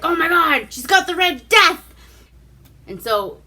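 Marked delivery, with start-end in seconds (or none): 0.0-1.8 higher, louder horrified cry; alarmed shout. Retold or imitated. Guard discovers the plague, loud horrified cry. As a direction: yell it like you've seen the plague. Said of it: "he's like".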